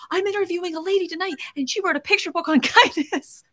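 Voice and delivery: high-pitched, quick, gleeful boast; excited gushing. Retold or imitated. Host telling a kid about tonight's guest, excited gushing. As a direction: gush it fast, breaking into laughter.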